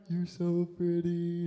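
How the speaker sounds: slow, dopey smitten reply; lovestruck daze. Retold or imitated. Sleepy guy gazing at his crush, lovestruck daze. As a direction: drift off dreamily, dragging it out.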